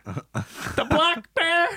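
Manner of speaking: high, wailing mock sob; high-pitched fake crying plea; mock sobbing. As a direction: ham up a distraught woman near tears. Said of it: "she's already sobbing".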